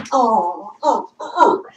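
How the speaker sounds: high rhythmic oh oh oh while dancing; mock dance hype sounds; dance grunts. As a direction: groove grunts to a beat. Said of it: "you can dance like this".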